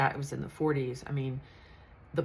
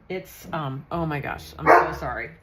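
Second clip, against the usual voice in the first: normal talking voice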